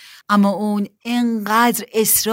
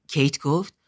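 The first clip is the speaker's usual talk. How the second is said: ordinary delivery, nothing marked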